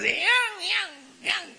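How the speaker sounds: high-pitched yips for yang; yapping small dog. Retold or imitated. Preacher imitating a small dog barking at someone near, yapping. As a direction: yap like a tiny dog.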